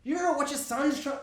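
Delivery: high, loud angry outburst; furious high shout; mock furious yell. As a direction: yell it at the father, high and hard. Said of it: "It's like".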